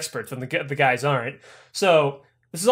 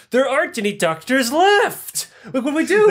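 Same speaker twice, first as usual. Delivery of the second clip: panicked; 8 semitones higher, 4 dB louder than usual. Acted. Panicked goons, no doctors.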